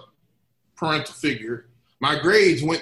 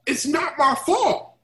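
put-on whiny teen protest; 13 semitones higher, slower than usual. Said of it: "And I'm like".

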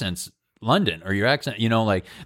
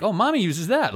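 put-on much higher, sweet voice to a small child; sing-song nanny voice; 10 semitones higher than usual. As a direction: coo it like a fake au pair. Fake nanny talking to child.

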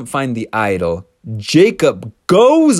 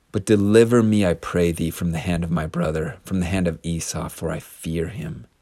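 humble prayer; 6 semitones lower than usual. Retold or imitated. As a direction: pray it with raw humility. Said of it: "Jacob's prayer to God".

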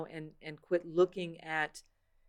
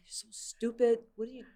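higher, mocking scold of yourself; harsh self-scolding; 6 semitones higher than usual. Voicing harsh self-talk.

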